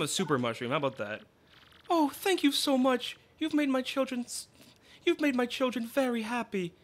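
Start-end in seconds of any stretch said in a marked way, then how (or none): 1.9-6.7 grateful mother. Acted. Mother thanks traveler.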